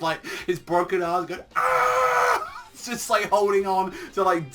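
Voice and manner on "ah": very high, loud yelping scream; mock scream of pain. Asta on the broom with broken arms, high pained scream. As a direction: yelp as if arms were broken. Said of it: "he's just screaming".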